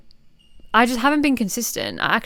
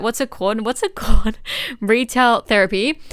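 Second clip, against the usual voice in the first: laughing search for the word; 2 semitones higher, 4 dB louder than usual. Real, not acted. Laughs while groping for word.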